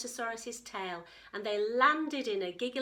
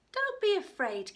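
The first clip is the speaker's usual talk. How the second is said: reassuring; 11 semitones higher, 8 dB louder than usual. A narrator's reading. Hadrosaurus comforts worried Katie.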